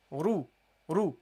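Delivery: "Ru, ru" said with a heavy r. Shown as a demonstration. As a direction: give "ru" a thick, dark r. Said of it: "have to pronounce it heavily".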